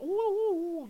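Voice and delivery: high, loud wavering "ooh"; mock eerie ooh. Picturing a fungi pond with cactus, mock high oohs. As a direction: hoot a high ooh for effect.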